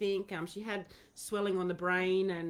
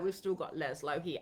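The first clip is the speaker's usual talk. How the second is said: usual speaking voice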